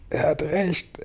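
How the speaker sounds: high, soft, strained groan. A narrator's reading. Grasshopper realises the crash is coming, groaning. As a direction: groan it out weakly in dismay. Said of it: "ächzte Grashüpfer".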